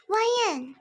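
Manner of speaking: loud, high call of a name. Acted. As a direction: shout the name across the room. Friends arriving and calling out.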